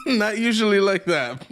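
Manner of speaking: high, laughing through the words. Real, not acted. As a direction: laugh the line out. Laughing hard.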